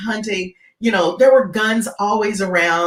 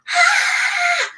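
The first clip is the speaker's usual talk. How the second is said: shrill-free high mock scream from the throat; high imitated scream. Teller throwing hands up, mock scream. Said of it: "she starts screaming".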